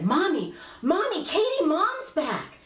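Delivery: put-on high-pitched excited little girl; excited child. Child running in to tell her mother, excited. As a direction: call out high, bursting with big news. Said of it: "I was so excited".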